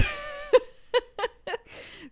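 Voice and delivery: high, loud, teary laugh; real laugh close to tears. Real, not acted. Mother laughs.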